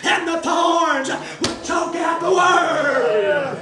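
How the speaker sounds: shouted, slow preaching; hollering revival preacher. Acted. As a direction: preach it out at the top of your voice. Preaching at full force.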